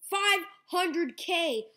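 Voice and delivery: slow, high, loud shout of disbelief; shocked excitement. Real, not acted. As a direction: yell it out stunned. Huge pack pull reveal.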